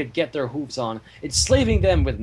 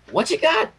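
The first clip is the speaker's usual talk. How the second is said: curious Pinkie; 7 semitones higher than usual. Acted. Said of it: "Pinkie asked".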